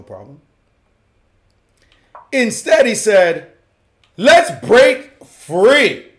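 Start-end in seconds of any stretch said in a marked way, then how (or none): 4.2-6.1 high, loud, very drawn-out battle cry; rousing cry. Retold or imitated. Commentator voicing a player's bold move, rousing cry. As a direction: call it out big, stretching each word.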